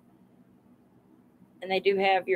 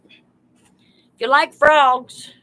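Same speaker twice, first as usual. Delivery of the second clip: drawn-out excited call; 2 semitones higher, 7 dB louder, much slower than usual. Real, not acted. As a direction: stretch it out slowly and loudly. Calling out to live viewers.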